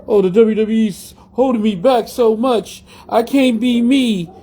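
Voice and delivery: high, slow whiny mimicry of a complaining wrestler; mock crybaby voice, pitched high and dragged out; mocking whine. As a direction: whine it out slowly like a spoiled crybaby. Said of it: "bitched, and moaned about fucking".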